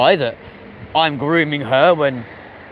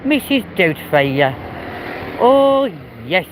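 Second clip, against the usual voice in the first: Missus Doubtfire impression; 3 semitones higher, slower than usual. Streamer doing a Welsh granny for chat, Mrs Doubtfire style. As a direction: ham up a sweet old granny. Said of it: "sound something close to, like, Missus Doubtfire".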